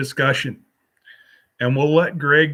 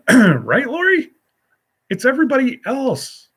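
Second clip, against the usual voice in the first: high teasing voice; 10 semitones higher than usual. Pretend. Joking with Lori about accents.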